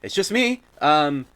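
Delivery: high, slow, soothing woman's voice; calm them down gently as the stranger; a high, slowed woman calming the party. Acted. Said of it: "you hear the voice of the woman".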